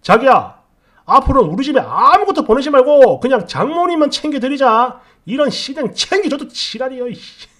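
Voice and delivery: acted-out furious outburst; very loud voice. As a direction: bellow it like a fed-up husband. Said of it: "엄청 큰 목소리로".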